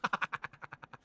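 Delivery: showing his own laugh on cue; demo laugh. Shown as a demonstration. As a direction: laugh the way you really laugh. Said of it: "When I laugh, I go".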